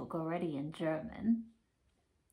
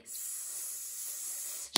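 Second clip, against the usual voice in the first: a breathy shush; hushing whisper; 10 dB quieter than usual. Acted. Teacher hushing children before peeking, whispered. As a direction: hush the room before the peek.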